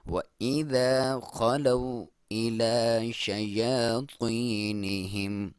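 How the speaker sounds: slow, drawn-out tajweed chant; slow Quran recitation. Shown as a demonstration. Teacher reciting the verse for students, slow and drawn out. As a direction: recite it slowly, stretching each vowel. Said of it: "तो को मोटा पढ़ेंगे".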